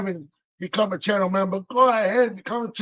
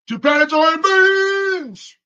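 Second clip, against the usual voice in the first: excited; 9 semitones higher, 5 dB louder, slower than usual. Real, not acted. Excited mention of store.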